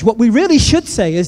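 ordinary talk, nothing marked

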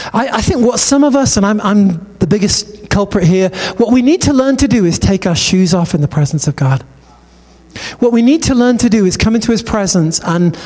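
usual speaking voice

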